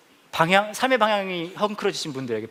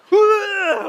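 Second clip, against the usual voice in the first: loud high gasp of amazement; startled wow; 10 dB louder, much slower than usual. Retold or imitated. Visitors startled by the portrait, shouting wow. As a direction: cry out in startled awe. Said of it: "막 이러는 거예요".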